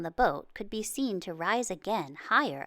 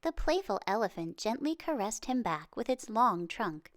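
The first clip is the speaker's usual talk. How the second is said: usual speaking voice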